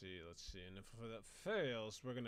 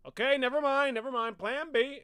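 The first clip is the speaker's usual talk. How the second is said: high, loud hasty backpedal; flustered friar; 11 semitones higher, 15 dB louder than usual. Retold or imitated. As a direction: drop the sermon and call for plan B. Friar giving up, switching plans.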